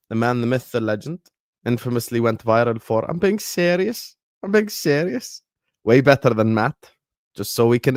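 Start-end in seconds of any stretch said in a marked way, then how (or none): 3.1-5.4 higher-pitched Scouse catchphrase; imitated Scouse accent, pitched up; Scouse impression. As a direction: put on a high Scouse voice with rising lilt. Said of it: "when he does a Scouse accent".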